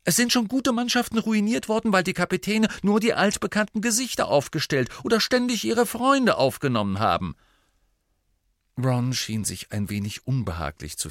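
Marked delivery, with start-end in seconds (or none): 0.0-7.4 high, loud, insistent lecture; admonishing. A narrator's reading. Teammate warns the new captain against picking friends, admonishing. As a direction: press the warning home in a raised, high voice. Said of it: "ermahnte sie ihn".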